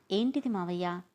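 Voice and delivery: ordinary delivery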